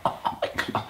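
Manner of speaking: hushed, voiceless mock dread; mock fearful whisper. Skateboarder dropping in on the six-foot ramp, mock fearful whisper.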